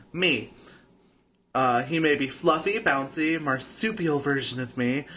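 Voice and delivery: ordinary talk, nothing marked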